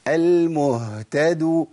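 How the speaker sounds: very slow "المهتدو" with a full breathed h; ha sounded. Shown as a demonstration. Teacher contrasts right with wrong, ha sounded. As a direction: linger on the h in "المهتدو". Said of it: "آه، طلعت الهاء".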